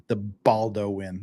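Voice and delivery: "Baldo win" said as two words, with a fake Spanish "Baldo"; mock name. Shown as a demonstration. Mocking Baldwin's name on his commentary channel, drawing out "Baldo win". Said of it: "We're just gonna start calling him Alec Baldo win".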